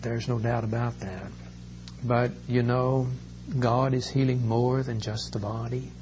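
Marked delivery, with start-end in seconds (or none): none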